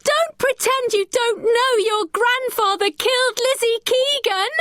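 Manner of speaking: put-on girl furious at the boy; cried angrily. A narrator's reading. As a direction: fling it back at him. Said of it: "Becky cried".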